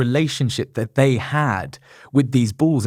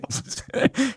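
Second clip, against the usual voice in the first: silent wheezing laugh, no voice; a helpless airy giggle; breathless laugh; 12 semitones higher, 5 dB quieter than usual. Real, not acted. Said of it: "I shouldn't laugh".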